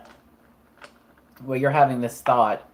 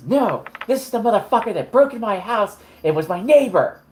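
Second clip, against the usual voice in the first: higher-pitched furious tirade; Carmen yelling; 10 semitones higher, 5 dB louder than usual. Acted. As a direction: lay into the thief who robbed her. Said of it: "she starts yelling at him".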